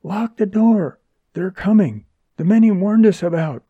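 high-pitched, anxious warning; urgent alarm. A narrator's reading. Woman telling Freddy to lock out approaching men, urgently alarmed. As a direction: warn him with mounting alarm.

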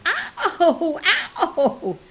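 high, agonized "ow" wail; helpless high-pitched yelp of pain; whimpering howl of pain. A narrator's reading. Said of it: "he was whimpering in extreme pain".

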